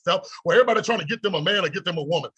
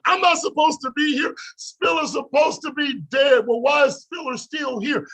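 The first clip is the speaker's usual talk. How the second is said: preaching shout; 7 semitones higher than usual. Real, not acted. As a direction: belt it out like the sermon's peak. Preaching climax about survival.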